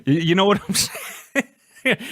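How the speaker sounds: chuckling through the question; giggling at his own joke about snowbirds; laughing. Real, not acted. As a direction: let the laugh break into the words.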